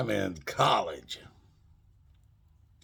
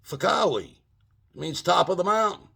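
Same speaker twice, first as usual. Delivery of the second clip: much higher, quick, innocent explaining; hammed-up innocent certainty; fake confident; 9 semitones higher, 3 dB louder than usual. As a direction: sell the lie with a straight face. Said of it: "I go".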